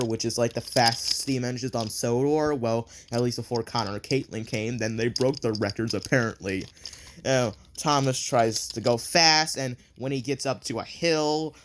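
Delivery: usual speaking voice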